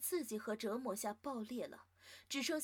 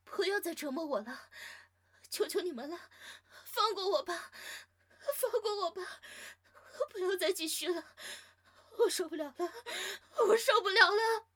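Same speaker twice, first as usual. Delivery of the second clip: high, loud pleading, building to sobs; desperate begging; 8 semitones higher, 8 dB louder than usual. Acted. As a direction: beg for mercy through tears. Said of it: "绝望地恳求".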